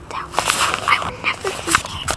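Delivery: soft, unvoiced whisper; whispered thought. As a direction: breathe the thought to himself. Character's private thought.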